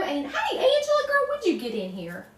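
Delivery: high, bright call to a friend; excited greeting. Real, not acted. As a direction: greet her like a long-lost pal. Greeting a friend joining the stream.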